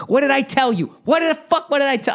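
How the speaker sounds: very high-pitched, exasperated scolding; Jimmy the Gent yelling. Acted. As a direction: lay into him like an enraged mobster. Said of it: "Jimmy the Gent is yelling at you".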